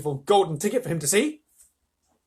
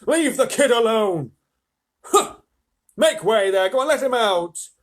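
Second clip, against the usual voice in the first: loud, raised, commanding call; shopkeeper shouting; 8 semitones higher, 8 dB louder than usual. A narrator's reading. Shopkeeper clears a way for the boy, shouting. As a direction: order the crowd back loudly. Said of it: "the fat shopkeeper shouted".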